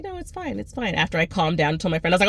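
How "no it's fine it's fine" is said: high, soft polite reassurance; sweet, airy "it's fine"; polite brush-off. Retold or imitated. Polite reply to late interviewer.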